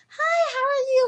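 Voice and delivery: high, loud sing-out hello; perky, exaggerated friendliness; cheery greeting. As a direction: imitate overly nice neighbors saying hello. Acts out friendly townspeople.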